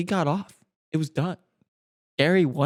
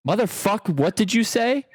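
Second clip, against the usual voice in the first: higher-pitched indignant challenge; dramatic, raised-pitch demand; angry confrontation; 9 semitones higher than usual. Acted. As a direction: confront them in mock outrage. Acted angry bar confrontation.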